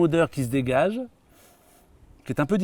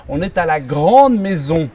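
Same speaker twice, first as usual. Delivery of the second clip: high, loud, emphatic announcing; presenter's big introduction; 6 semitones higher, 8 dB louder than usual. Acted. Host presents a guesthouse in Savièse, showy presenter voice. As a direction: sell the place grandly, leaning on each superlative.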